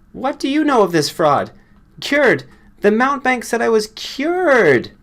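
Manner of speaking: high, loud furious rant; furious outburst. A narrator's reading. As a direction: rage at the fraud who cured her. Furious woman accusing doctor.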